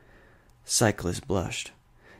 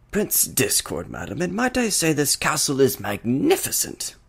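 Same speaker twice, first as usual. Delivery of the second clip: higher, smooth, theatrical prince voice; smooth Prince Discord; 7 semitones higher than usual. A narrator's reading. Discord greets the princess, smooth and theatrical. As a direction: glide through it with playful charm. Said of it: "His voice was smooth, yet accented at the most random of times".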